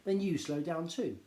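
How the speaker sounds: plain reading voice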